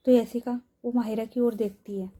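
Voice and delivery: ordinary delivery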